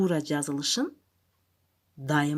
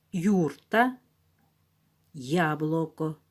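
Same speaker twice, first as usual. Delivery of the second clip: reading a word list; slower than usual. Shown as a demonstration. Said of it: "Повторяйтесь вместе со мной".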